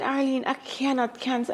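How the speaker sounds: soft, high anxious protest; hushed protest. Retold or imitated. [name] protesting to Arlene, soft and anxious. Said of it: "So I said".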